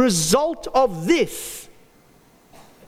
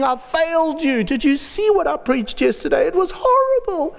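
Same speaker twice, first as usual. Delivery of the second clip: self-pitying confession; 9 semitones higher than usual. Retold or imitated. Imagines confessing to God.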